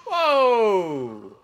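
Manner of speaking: sudden loud high startled cry; startled whoa. Real, not acted. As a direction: jump at the surprise. Startled by something in game.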